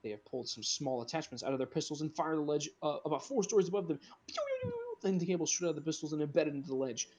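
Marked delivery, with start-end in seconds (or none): 4.3-4.8 high, quick mouth-made laser "pew" shots; imitated laser blasts. Grappling pistols fired, imitated.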